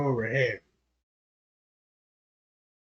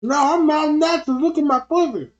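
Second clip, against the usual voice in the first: higher, louder, insisting; high woman voice; 10 semitones higher, 5 dB louder than usual. Acted. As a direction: pitch way up as the woman. Woman character protests high.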